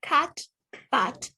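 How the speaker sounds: usual speaking voice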